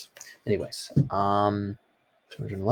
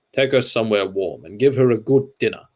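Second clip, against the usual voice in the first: commanding; 4 dB louder than usual. A narrator's reading. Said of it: "he commanded".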